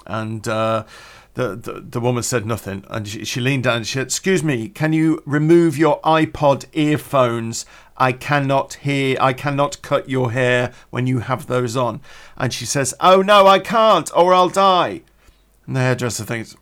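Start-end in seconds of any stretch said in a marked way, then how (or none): 4.1-12.0 slow, louder, word-by-word asking; slow, emphatic request. Retold or imitated. Hairdresser to customer wearing headphones, slow and emphatic. 13.0-15.0 high, loud mock-panicked refusal; hammed-up high wail of protest; panicked blonde. Blonde refusing the hairdresser, mock panic.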